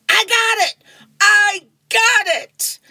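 excited shout. Acted. Lucy on the field calls for the fly ball, high excited shout. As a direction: call for the ball like an eager kid.

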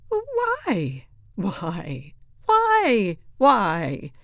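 loud, nagging repeated why; pestering whys. A narrator's reading. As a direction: badger the teacher, each why different. Pupil pesters teacher with whys.

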